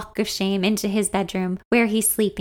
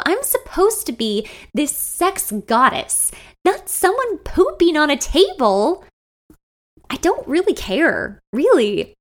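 mortified rant; 7 semitones higher than usual. Acted.

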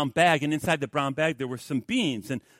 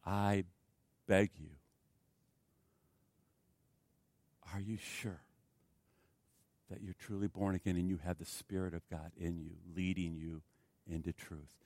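soft pleading; 8 semitones lower, 9 dB quieter than usual. Real, not acted.

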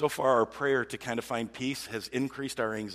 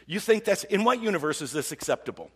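raised, exasperated challenge; louder, higher imagined telling-off; frustrated; 6 semitones higher, 6 dB louder than usual. Acted. Driver rehearsing words to an absent offender, frustrated. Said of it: "I'm, like, frustrated with somebody".

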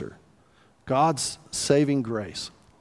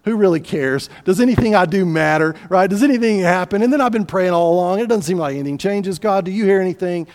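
building louder into a pleading complaint; exasperated despair; 4 semitones higher, 5 dB louder than usual. Acted. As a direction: act out mounting frustration with God. Acted fatalist's rising complaint to God.

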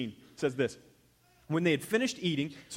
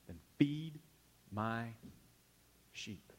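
each word set apart, softer and softer; soft, drawn-out command; 4 semitones lower, 8 dB quieter, much slower than usual. Shown as a demonstration. Pastor showing how Jesus told Peter, softly and slowly. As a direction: let the words fade to almost nothing. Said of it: "I think he probably said it like that".

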